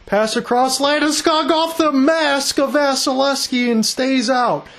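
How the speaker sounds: urgent hockey commentator's surge; excited play-by-play. Real, not acted. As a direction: call the near goal as it happens. Live call of a near goal.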